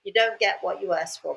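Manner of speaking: ordinary talk, nothing marked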